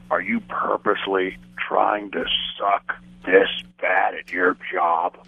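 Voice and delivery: slow, low, flat disdain from the boss; a lightly voiced scolding boss, dragged out slowly; imitating the boss. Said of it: "And Vince goes".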